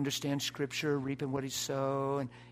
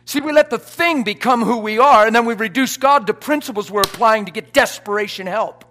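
loud, high, exultant proclamation; full-force preaching; 7 semitones higher, 18 dB louder than usual. Real, not acted. Preacher retelling his triumph leaving the hospital, full-force preaching. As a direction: shout the victory over the lying spirit.

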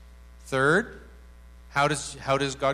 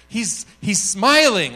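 raised, loud, slow drawn-out answer; manic delight; 6 semitones higher, 9 dB louder, slower than usual. Retold or imitated. Woman describing God's face to the speaker, manic delight. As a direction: imitate delighted discovery. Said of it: "she was manic".

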